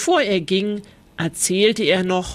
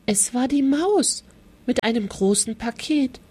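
ordinary delivery, nothing marked

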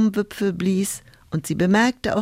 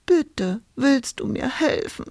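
mildly sobbed plea, higher pitch; understated tearful request, pitched up; sobbing; 6 semitones higher than usual. A narrator's reading. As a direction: beg as if choking back tears. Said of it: "schluchzte Hulda".

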